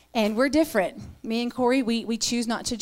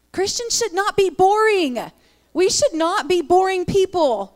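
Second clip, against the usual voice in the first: raised, emphatic preaching voice; forceful preaching; 8 semitones higher, 6 dB louder than usual. Real, not acted. Preacher urging the church not to be boring, forceful. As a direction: drive the point home to the room.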